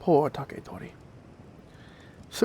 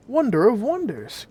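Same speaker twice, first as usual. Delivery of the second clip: cried out; 8 semitones higher, 4 dB louder than usual. A narrator's reading. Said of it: "cried Taketori".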